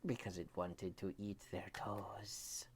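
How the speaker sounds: hushed whisper of a hungry monster; spooky bedtime-story whisper; creepy whisper. Acted. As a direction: whisper it like a lurking vacuum. Vacuum cleaner speaking.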